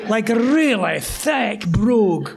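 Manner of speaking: normal talking voice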